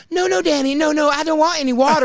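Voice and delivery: Matt refusing. Retold or imitated. Matt refusing water for his soda, high and insistent. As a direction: wave the water away insistently.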